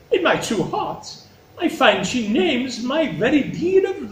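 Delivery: high, measured, theatrical courtly speech; Regan, gushing flattery. Acted. Regan outdoing her sister before the king, high gushing. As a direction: lay on the devotion in a high, stately voice.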